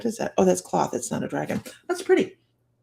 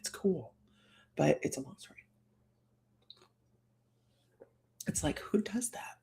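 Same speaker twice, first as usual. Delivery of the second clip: hushed whispered aside; 4 semitones lower, 12 dB quieter than usual. Real, not acted. Whispering an aside to the viewers. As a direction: lean in and whisper it.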